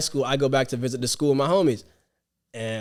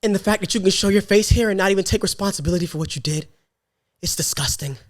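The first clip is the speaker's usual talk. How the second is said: building, scolding reproach that drops to disgust; acted indignation; 4 semitones higher than usual. Teacher demanding the student take responsibility, acted indignation. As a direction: play an offended teacher going off. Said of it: "She was, like, going off".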